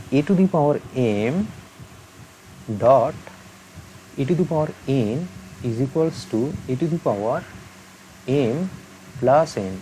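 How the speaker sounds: very slow, word by word, for students to write down; slow dictation. Shown as a demonstration. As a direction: dictate the formula slowly so they can copy it. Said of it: "পারলে খাতায় টুকে নেবে জিনিসটা". (ধীরে ধীরে শ্রুতিলিখন)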